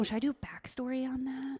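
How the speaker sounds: soft, whispered check-in; whispered aside. Real, not acted. Guest asks host about giving backstory, whispered aside. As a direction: drop to a whisper to check quickly.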